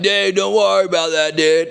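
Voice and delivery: lightly voiced laid-back surfer dude. A hint of surfer dude telling the crew to relax. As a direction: toss it off like a chill surfer. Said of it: "like, a surfer dude".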